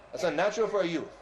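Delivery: ordinary delivery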